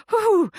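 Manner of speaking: high, loud whoosh of anger going off; volcano eruption sound. Retold or imitated. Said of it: "like this volcano erupted inside me".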